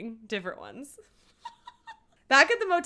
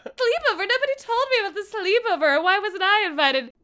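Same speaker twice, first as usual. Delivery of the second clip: imitating Moira; 8 semitones higher than usual. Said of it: "Moira comes in".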